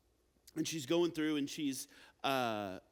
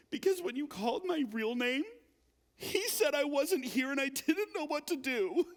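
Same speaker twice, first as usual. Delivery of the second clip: put-on higher, small child's voice, earnest; little kid; 9 semitones higher than usual. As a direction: play the confused kindergartner explaining. Little kid explains to teacher.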